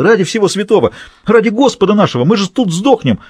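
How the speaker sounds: high, agitated pleading outburst; barked, desperate protest. A narrator's reading. Prisoner fears dying in the burning building, barked protest. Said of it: "рявкнул Пэл".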